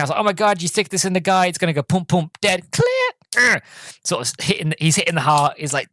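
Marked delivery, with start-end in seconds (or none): 0.2-3.6 higher, mock panicked rant; lightly acted freak-out. Driver panicking over the damaged heart, mock freak-out. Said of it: "Wayne's freaking out".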